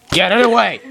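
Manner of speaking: loud, high panicked yell; shouting. Real, not acted. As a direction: yell to get it away. Escalating plea, yelled.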